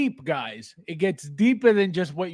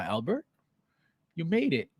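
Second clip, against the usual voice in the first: normal talking voice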